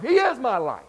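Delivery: loud, high burst of conviction; emphatic declaration. Real, not acted. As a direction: proclaim it, voice leaping up. Preacher declares his faith emphatically.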